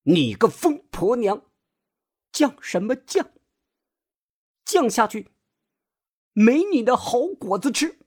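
high-pitched, sharp scolding; stern angry roar. A narrator's reading. Grandma berating her stubborn daughter-in-law, stern and angry. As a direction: lay down the law as head of the household. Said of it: "厉声吼道".

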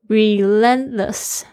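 Relentless said slowly with the T after N barely heard; slow Relentless, the T softened away after the N; weakened T. Shown as a demonstration. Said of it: "那个T好像听不到了".

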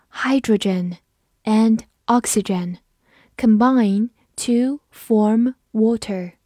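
slow phrases with long pauses; slow read-through. Shown as a demonstration. Teacher models a chemistry sentence for learners, slow read-through. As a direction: read it slowly, piece by piece. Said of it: "这个句子我们慢读一遍".

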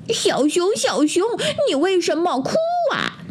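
high little ant voice, mock shouting; ant calling out. A narrator's reading. Ant calls to the crying bear, high mock shout. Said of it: "蚂蚁大声地喊".

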